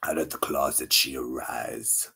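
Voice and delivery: slow dramatic whisper; hushed storybook announcement. Acted. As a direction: breathe it like a secret reveal. Cat emerging, hushed announcement.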